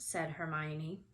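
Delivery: usual speaking voice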